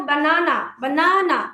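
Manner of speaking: slow বানানা ba-na-na; slow three syllable demo. Shown as a demonstration. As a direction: drag বানানা into three parts. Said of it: "তিনটা পার্টে উচ্চারণ করছি".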